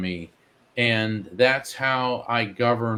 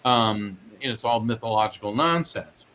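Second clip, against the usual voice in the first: ordinary delivery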